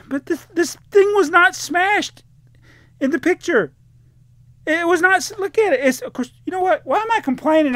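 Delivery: high, loud exasperated rant; angry rant. Real, not acted. Vlogger complaining about an eBay seller, high and exasperated. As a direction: vent at the careless seller, pitch climbing. Ranting about a smashed box.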